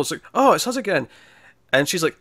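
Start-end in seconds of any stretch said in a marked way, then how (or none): 0.3-1.1 mock surprise. Couple meeting again.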